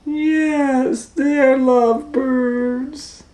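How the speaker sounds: high, slow, drawn-out singsong; dreamy gushing. Acted. Piggy delighting in the lovebirds, high slow gushing. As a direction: sigh it out high and slowly.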